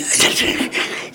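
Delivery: soft laugh. Real, not acted. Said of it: "j'étais mort de rire".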